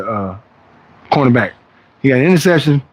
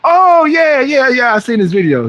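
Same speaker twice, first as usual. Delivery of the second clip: mock excited stranger, starting much higher; sudden recognition; 10 semitones higher, slower than usual. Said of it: "You know, the guy who says".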